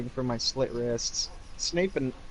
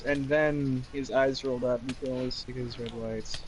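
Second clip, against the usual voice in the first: ordinary talk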